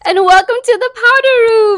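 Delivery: loud, high showbiz welcome; excited shout. Real, not acted. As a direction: announce the show with a big cheer. Opening the podcast, hosts cheering.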